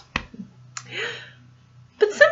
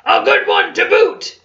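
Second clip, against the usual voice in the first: pirate crew; 7 semitones higher than usual. Acted. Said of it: "the others agreed".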